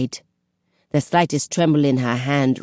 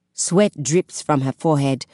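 plain reading voice

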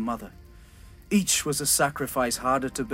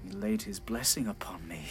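whispered; 5 semitones lower, 9 dB quieter than usual. Acted. King's grim reflection.

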